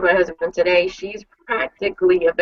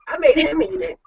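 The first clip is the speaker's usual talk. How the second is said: usual speaking voice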